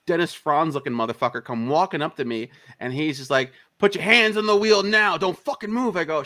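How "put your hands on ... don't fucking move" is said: high, loud barked order; retold urgent police shout; imitated police command. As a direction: shout it like a cop taking control. Cop orders him at gunpoint.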